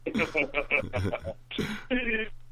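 soft, breaking-up laugh; helpless laughter. Real, not acted. Storyteller laughing at the ex's text, helpless laugh. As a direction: crack up at your own story.

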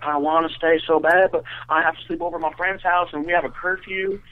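put-on the girl's voice. Girl explaining her curfew to her date, high mock-pleading voice. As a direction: imitate her pleading, pitched well up. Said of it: "the girl's voice, uh, was hysterical".

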